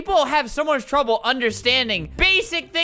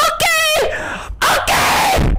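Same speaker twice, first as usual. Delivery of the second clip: high-pitched shrieking laugh into a yelled okay; slower than usual. Real, not acted. Streamer losing it at Coco's endless okays, shrieking laugh. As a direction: crack up and screech the okays. Exasperated at Coco saying okay.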